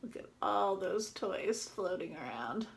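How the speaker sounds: lively storybook reading as the duck; reading to children. Acted. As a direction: read it out to the class like a picture book. Teacher reads picture book aloud.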